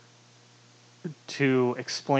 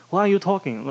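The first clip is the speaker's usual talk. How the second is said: higher-pitched, lightly attempted Southern accent; bad Southern accent; 6 semitones higher than usual. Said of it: "his southern accent is terrible".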